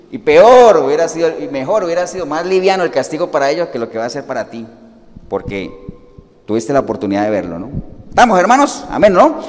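usual speaking voice

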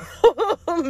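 a quick high laugh; short amused laugh; burst of laughter. Real, not acted. Laughing at Megan Fox.

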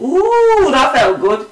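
long high "ooh" of guilty pleasure; hammed-up delighted realisation; relishing. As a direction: voice the killer savouring it, starting high. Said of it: "and thought".

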